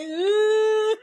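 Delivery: high teary ooh; mock crying whimper. On the phone with mother-in-law, high teary whimper. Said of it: "And I'm like".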